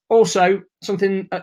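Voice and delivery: ordinary talk, nothing marked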